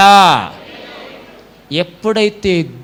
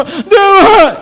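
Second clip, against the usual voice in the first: mock prayer cry; 11 semitones higher, 5 dB louder, much slower than usual. Mocking a believer's prayer cry.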